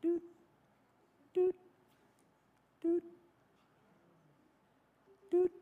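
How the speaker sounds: imitating a radar blip; short beeps spaced out like a sonar sweep; playful radar doot noises. Shown as a demonstration. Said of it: "he's just scanning the earth".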